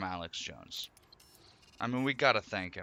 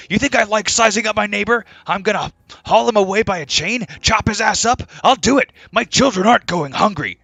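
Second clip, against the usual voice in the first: loud, high, ranting radio host voice; impression of Alex Jones; 11 semitones higher, 17 dB louder than usual. Mocking a radio host reading his own cannibal rant aloud, loud ranting. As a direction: rant it like a furious conspiracy host. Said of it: "Jones said on a recent episode".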